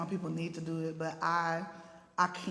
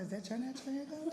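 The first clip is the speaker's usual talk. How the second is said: mock retort thrown back, higher and softer, fast; lightly imitated comeback, higher and quicker; sassy echo; 7 semitones higher, 7 dB quieter, faster than usual. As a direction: toss her question right back with sass. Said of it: "I was like".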